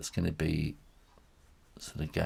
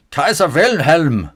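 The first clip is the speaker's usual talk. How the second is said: loud, high, slow German proclamation; mock German accent; 8 semitones higher, 13 dB louder, slower than usual. Artist likening dog whiskers to the Kaiser's, mock German accent. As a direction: boom it out in a hammed-up German accent.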